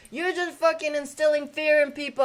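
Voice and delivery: loud, high accusation; retold yell. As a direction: shout it down the street at them. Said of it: "And he was like, saying".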